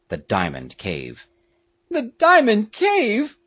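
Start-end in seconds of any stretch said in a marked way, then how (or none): 1.9-3.4 high, loud, drawn-out alarm; slow, high cry of disbelief; shocked Peterkin cry. A narrator's reading. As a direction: echo it back in high-pitched dismay. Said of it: "cried Peterkin".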